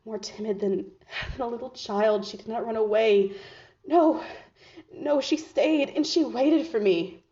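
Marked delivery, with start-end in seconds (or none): 3.9-7.2 higher, louder burst of emotion; overcome with wonder. Acted. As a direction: insist on it as if barely believing it. Insists she did not flee.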